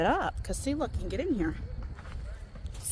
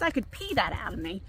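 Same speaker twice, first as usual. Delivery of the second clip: ordinary talk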